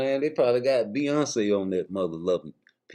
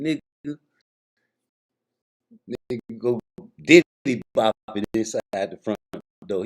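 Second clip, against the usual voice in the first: normal talking voice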